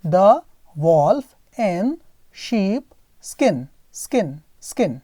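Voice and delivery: title read very slowly, word by word; slow model reading. Shown as a demonstration. Teacher reading the title slowly for students to repeat. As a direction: read it out slowly for the class to copy. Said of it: "कैसे पढ़ेंगे?".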